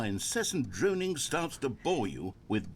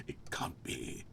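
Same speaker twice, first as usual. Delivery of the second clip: sudden hushed whisper; 10 dB quieter, slower than usual. Pretend. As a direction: drop to a secret whisper. Dramatic aside inside mock monologue.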